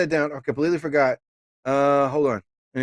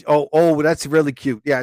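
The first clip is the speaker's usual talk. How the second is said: ordinary talk, nothing marked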